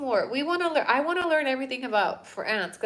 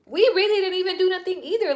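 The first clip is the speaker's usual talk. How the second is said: higher, louder indignant protest; indignant hold on; 10 semitones higher, 6 dB louder than usual. Retold or imitated. Vlogger voicing Mexico's outrage, high and loud. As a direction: protest loudly as if wronged. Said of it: "I'm like".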